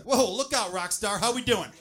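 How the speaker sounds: loud, high, startled exclaiming; astonished whoa. Acted. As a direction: react like it nearly hit your eye.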